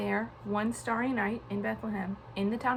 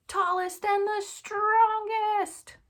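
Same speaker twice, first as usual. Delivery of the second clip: playful emphasis; 11 semitones higher, 5 dB louder, much slower than usual. A narrator's reading. Stressing tallest and strongest.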